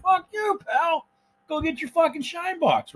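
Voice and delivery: high, hammed-up angry retort; angry mock reply. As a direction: snap back in a put-on voice.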